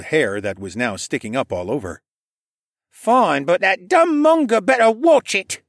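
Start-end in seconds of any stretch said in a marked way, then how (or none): 2.9-5.6 put-on high, louder kid's threat; a boy making a tough threat; snarling boy. A narrator's reading. Stable boy threatening the bird, high snarl. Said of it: "he snarled".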